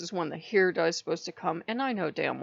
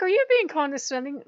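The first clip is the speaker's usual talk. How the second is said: higher, louder suspicious challenge; mock indignant question; 9 semitones higher, 4 dB louder than usual. Questioning Asmodeus after he calls her princess, mock indignant. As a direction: bristle as if caught being patronised.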